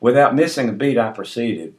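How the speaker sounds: usual speaking voice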